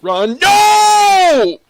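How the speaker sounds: very high, very loud yell; desperate scream. Real, not acted. Player dying in the game, desperate screaming. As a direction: cry out, erupting into a long scream.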